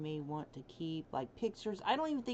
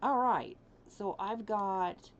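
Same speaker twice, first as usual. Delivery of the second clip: ordinary talk, nothing marked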